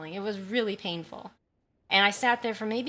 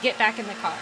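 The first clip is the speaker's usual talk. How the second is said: angry command; 5 dB louder than usual. Retold or imitated. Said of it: "I've never seen Safdie angry like this".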